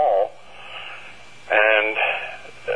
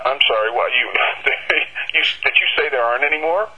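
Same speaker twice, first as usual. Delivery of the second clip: stammered, higher-pitched disbelieving question; incredulous; 4 semitones higher, faster than usual. Retold or imitated. Bookseller questions the New York supplier about sold-out copies, incredulous. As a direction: stumble into a quick, astonished double-check. Stunned reply on being told none.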